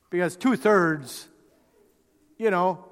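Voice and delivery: ordinary talk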